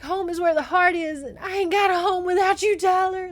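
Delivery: high, loud, hammed-up heartbreak; re-enacted wail. Reader recaps girl's plea to her love, wailing dramatically. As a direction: belt it out as the tragic heroine. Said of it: "And she's like".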